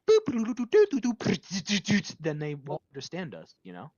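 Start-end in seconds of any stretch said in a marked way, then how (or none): 0.1-2.2 high, louder doo-doo robot chatter; robot babble. Pretend. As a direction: babble like a coded machine. Imitates AI secret language.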